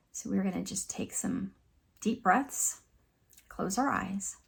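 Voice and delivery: soft, calm meditation guide with long pauses; hushed, soothing relaxation voice; guided meditation. Acted. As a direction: gently lead them through breathing and reflection, leaving space.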